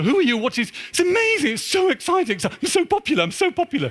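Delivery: very high, urgent calling of dad; excited teen. Retold or imitated. Son running upstairs to tell his father big news, very high and excited. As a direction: burst in breathless with news, pitch way up.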